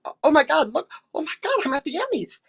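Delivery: high, rising giddy squeals; thrilled gasp. Retold or imitated. Actress watching herself on tape, excited. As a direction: gush like a starstruck fan. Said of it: "I go".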